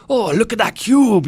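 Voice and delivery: put-on high, slow, loud Scottish accent; a hammed-up Scottish puppet. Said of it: "try and do a Scottish accent".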